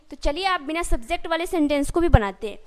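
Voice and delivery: ordinary talk, nothing marked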